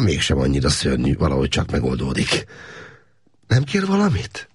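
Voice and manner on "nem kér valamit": much higher, kind offer; caring woman. A narrator's reading. Adrien asks the prisoner if he needs anything. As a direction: offer help sweetly. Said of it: "kérdezte a nő".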